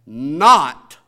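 "not" punched out very loud, alone between pauses; isolated, booming "not"; stress on NOT. Shown as a demonstration. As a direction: hit the word "not" hard. Stresses serpent's contradiction of God.

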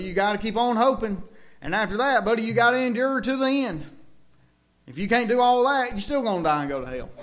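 high, loud fiery preaching; preaching at full force. Acted. Preacher thunders at congregation about hell, fiery preaching. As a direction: hammer it home like a revival preacher. Preaching hard against doctrine.

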